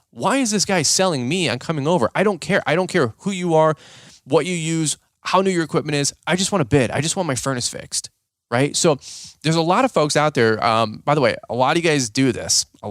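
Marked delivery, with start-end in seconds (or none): 0.1-8.1 impatient customer. Retold or imitated. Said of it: "I would think, like".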